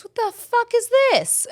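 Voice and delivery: high, loud disbelieving squawk; incredulous sister. Retold or imitated. Sister reacting to the face oil.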